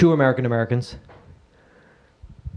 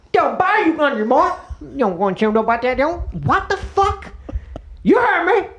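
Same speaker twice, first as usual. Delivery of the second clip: high, garbled, indignant local; mock-outraged high-pitched babble; hammed-up local character; 11 semitones higher than usual. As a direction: challenge someone in cartoon local gibberish. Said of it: "I don't understand what you're saying".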